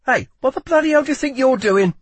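fast, high, loud protest; alarmed outburst. A narrator's reading. Lila sees guard cutting his arm, alarmed outburst. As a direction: break off into a startled shout.

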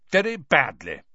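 sulky, irritated reply; peevish. A narrator's reading. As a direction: answer peevishly. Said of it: "said Percy peevishly".

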